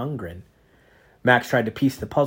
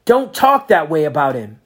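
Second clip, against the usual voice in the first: louder angry outburst; boy shouting back at the Inquisitor; 5 semitones higher, 9 dB louder than usual. A narrator's reading. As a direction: snap back in his mentor's defense. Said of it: "Max shouted".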